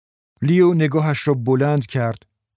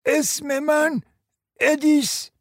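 put-on very high, drawn-out old lady's voice; frail-old-woman character, pitched far up; slow, clear old woman; slower than usual. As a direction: pronounce each word slowly and distinctly. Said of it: "آهسته و واضح".

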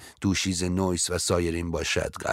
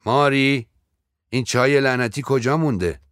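higher, louder call toward the doorway; raised voice; 6 semitones higher, 4 dB louder than usual. A narrator's reading. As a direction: call out impatiently for the maid. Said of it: "با صدایی که بالا برده بود".

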